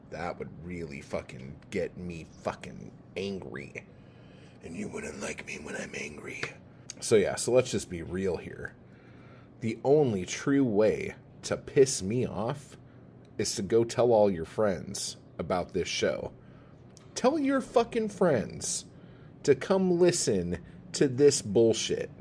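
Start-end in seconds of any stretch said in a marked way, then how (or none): 4.6-6.4 whispered mock threat, soft and breathy; a hushed pretend threat to the listeners; mock menacing whisper. 17.2-22.1 sarcastic. Acted. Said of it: "that sarcasm was laid on thicker".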